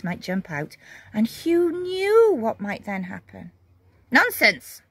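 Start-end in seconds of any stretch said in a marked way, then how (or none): 4.1-4.8 firm. A narrator's reading. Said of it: "said the old lady firmly".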